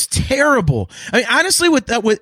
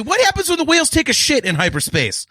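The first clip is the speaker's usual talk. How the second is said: ordinary talk, nothing marked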